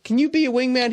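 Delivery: loud, high exasperated request; imitating an exasperated ask; mock pleading. Retelling his plea to friend.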